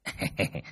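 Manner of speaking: sheepish little heh-heh; soft chuckle. A narrator's reading. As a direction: chuckle softly to himself. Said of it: "mà cười hè hè".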